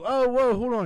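high, loud startled whoa; startled yelp. Retold or imitated. Woken in the tub, yelping high and loud in surprise. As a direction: jolt awake and yelp in alarm. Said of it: "I'm like, I'm like".

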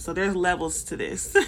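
ordinary talk

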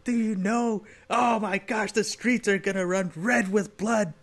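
high, slow mock-alarmed chief; police chief. Host mimics police chief warning of bloodshed, high mock alarm. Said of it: "like the police chief at the beginning".

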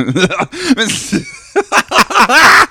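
high shouted laugh; shrieking laugh. Real, not acted. Laughing at gun joke.

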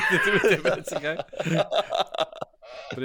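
real laughter breaking into the words; laughing while repeating. Real, not acted. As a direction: crack up while echoing the joke. Repeating partner's joke, laughing.

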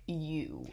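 "You." set apart, low and soft; pointed "You.". Retold or imitated. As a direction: land "You." pointedly on its own. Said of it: "she very pointedly is like".

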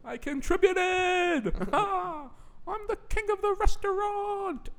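put-on high, slow, loud kid voice; childish shout. Recalling childhood birthdays, high-pitched childish proud shout. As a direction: proclaim it like a bragging child. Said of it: "I used to say that every time".

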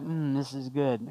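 soft, low murmur of contentment; quiet inner voice. Acted. Pastor voicing a private celebration in the heart, quiet and contented. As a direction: say it softly, as if savouring it privately. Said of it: "even in your heart to be like".

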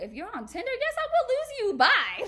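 high, loud, hammed-up send-off; exaggerated sassy brush-off; mock dismissal. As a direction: dump them with a big theatrical goodbye. Acts out dumping him.

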